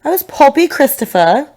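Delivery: put-on playful British accent, lifting at the end. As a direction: introduce yourself as a British alter ego. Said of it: "I can't do a British accent".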